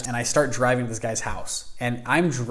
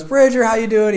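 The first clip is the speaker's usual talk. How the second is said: loud, high-pitched hello; enthusiastic retold greeting; 10 semitones higher, 7 dB louder than usual. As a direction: greet a guest with big, booming enthusiasm. Host greets guest on entry.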